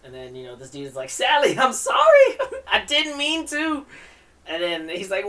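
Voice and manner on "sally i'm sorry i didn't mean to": put-on pleading boy voice. Dude apologizes in read comic.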